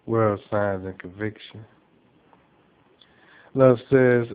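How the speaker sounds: usual speaking voice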